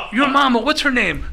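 high, loud, eager imitation; Tommy Rich. Tommy Rich pressing the waiter, excited high imitation. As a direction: press for an answer in hammed-up excitement. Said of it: "And Tommy's just going".

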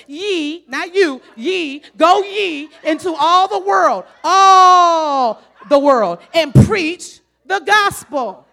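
slow, loud King James thunder, stretched; Old Testament proclamation. Acted. Preacher quoting the commission, slow loud proclamation. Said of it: "I'll use the Old Testament word".

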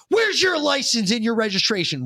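high, bossy trooper voice; mock trooper. Mocking the trooper who pulled him over, high and bossy. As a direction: bark it like a stern cop.

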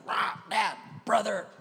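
raspy mock kid tantrum yell; retold child's yelling. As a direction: imitate an upset daughter storming in. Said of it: "my daughter came up yelling".

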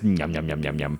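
low soft greedy yum yum; low yum noise. Retold or imitated. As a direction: murmur yum like savouring food. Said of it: "I'm like".

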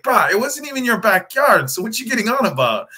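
high, loud mock local voice, scoffing; exasperated local. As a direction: brush off the newcomers in a high put-on voice. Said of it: "And it's like".

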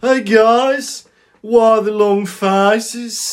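high, loud Brummie drawl; Brummie shrunken head. Acted. Shrunken head greeting passengers, hammed-up Brummie accent. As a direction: call it out slowly in broad Brummie. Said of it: "imagine a Brummie shrunken head".

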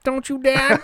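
high, boyish put-on voice; high Pee-wee voice. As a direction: pitch up for a whiny kid voice.

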